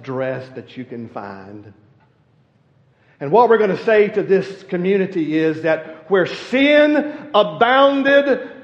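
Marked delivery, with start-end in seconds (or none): 3.2-8.5 loud, ringing pulpit preaching; loud, rising preacher's fervour; preaching at full force. Acted. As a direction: preach it out to the whole church.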